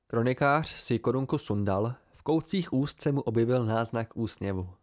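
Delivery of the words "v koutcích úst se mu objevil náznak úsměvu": usual speaking voice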